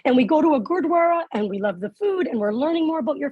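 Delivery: gushing parents. Retold or imitated. Parents telling the driver about the Gurdwara, gushing. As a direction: gush on as overexcited parents.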